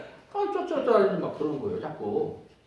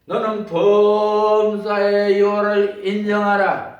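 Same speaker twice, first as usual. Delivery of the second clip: slow, loud preaching of the verse; scripture proclaimed; 4 semitones higher, 9 dB louder, slower than usual. Acted. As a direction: proclaim it over the church, slowly. Quoting scripture at full force.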